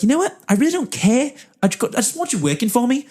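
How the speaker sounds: high-pitched, emphatic bad New Zealand accent; Richard. Interviewer offering a job regardless, emphatic New Zealand accent. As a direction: make the offer in an amateur Kiwi accent. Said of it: "do a very bad New Zealand accent".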